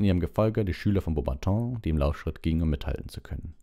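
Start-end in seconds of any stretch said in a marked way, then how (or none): none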